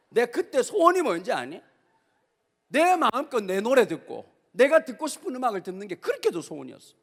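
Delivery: normal talking voice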